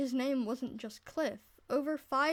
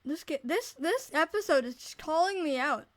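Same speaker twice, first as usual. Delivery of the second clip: loud amused; 5 semitones higher, 8 dB louder than usual. Real, not acted. Laughing at herself.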